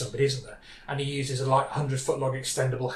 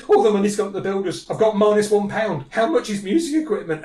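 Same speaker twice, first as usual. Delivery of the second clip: higher, loud imitation of a panicking boy; mock panic; 4 semitones higher, 5 dB louder than usual. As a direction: blurt it out in hammed-up panic. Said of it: "he's basically hyperventilating".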